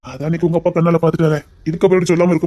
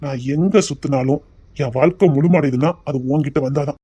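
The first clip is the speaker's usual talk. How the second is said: ordinary talk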